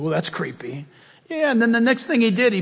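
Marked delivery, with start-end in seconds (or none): 0.0-0.9 lower, softer deadpan mutter as Peter; a quiet deadpan aside; Peter's wry aside. Retold or imitated. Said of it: "He said".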